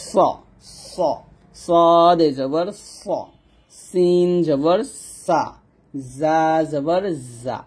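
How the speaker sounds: long hissed স and সা, buzzing জা, drawn out; whistling sibilants. Shown as a demonstration. Teacher shows the sound leaving the mouth, whistling. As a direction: let a whistle come out on each স and জা. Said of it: "আমার মুখ দিয়ে একটা শব্দ চলে আসতেছে".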